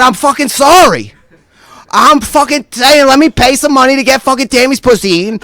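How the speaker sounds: high, loud sarcastic apology; defensive outburst. Retold or imitated. Defending himself in acted quarrel.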